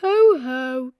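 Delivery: jolly booming ho ho; a fatherly chuckle; Daddy Pig laughing. A narrator's reading. Daddy Pig laughing at Peppa, jolly. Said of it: "laughed Daddy Pig".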